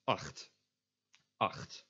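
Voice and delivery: "acht" with CH as a throat fricative; "acht" said twice as "akht", scraped Dutch G; Dutch CH sound. Shown as a demonstration. Teacher giving the word for eight, Dutch CH. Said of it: "always pronounced as a G".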